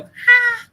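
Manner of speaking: short, loud, high-pitched yelp; sudden high shout on request; high yell. Shown as a demonstration. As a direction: let out one shrill shouted "haan". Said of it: "चिल्ला के बता एकदम".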